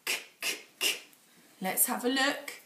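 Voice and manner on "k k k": k as a short unvoiced click; phonics sound k. Shown as a demonstration. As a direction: click out k, k, k with no vowel. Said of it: "a kicking k".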